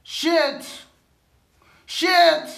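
frustrated curse. Real, not acted. Fan cursing after praising the coach, exasperated outburst.

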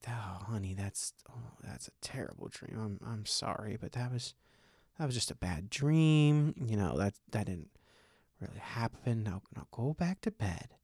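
quiet comforting father voice; soothing dad. Retold or imitated. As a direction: hush her back to sleep gently. Comforting daughter after nightmare.

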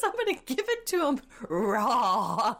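laughing through it. Retold or imitated. Finally getting the crude quote out, laughing through it. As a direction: force the quote out between laughs.

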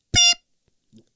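squeaky imitated beep; high beep tone. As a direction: chirp the tone. Phone tone sound.

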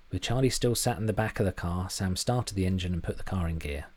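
plain reading voice

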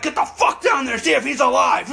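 a dad ordering his sons to go check; angry dad. Retold or imitated. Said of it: "my dad's like".

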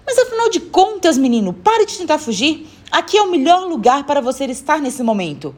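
high, urgent scolding; exasperated plea. Acted. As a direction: urge the child to stop running away. Woman grabs boy, pleads to stop. (bronca aflita e insistente)

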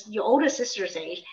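normal talking voice

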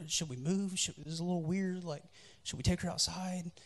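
awkward soft-voiced question to a friend; sheepish aside. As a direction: murmur it so the people nearby won't hear.

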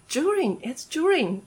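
slow during with a J sound, juring; British during. Retold or imitated. Quoting a British friend, slow British during. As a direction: quote the Brit saying during as juring. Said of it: "然后他就说".

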